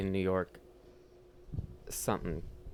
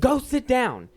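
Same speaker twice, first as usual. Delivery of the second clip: very high, very loud re-enacted command; screamed order; 11 dB louder, much slower than usual. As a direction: yell it at an unruly customer. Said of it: "I would start screaming back".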